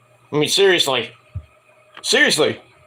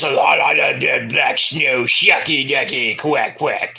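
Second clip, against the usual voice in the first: imitating Booker T; 3 semitones lower, slower than usual. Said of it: "he's, like, talking like this".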